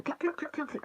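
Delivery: high, quick hen clucks; imitated chicken clucking. Vlogger imitating chickens in the garden, clucking. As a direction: squawk softly like a backyard chicken.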